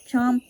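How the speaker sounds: loud punchy chomp. Acted. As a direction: bite down on the word. Chewing sound word.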